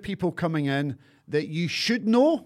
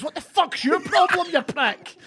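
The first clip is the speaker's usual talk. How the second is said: higher imitated confrontation; mock anger; 10 semitones higher than usual. Confronting the man who tapped him, mock angry. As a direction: snap it at the stranger, retold. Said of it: "I turned around and goes".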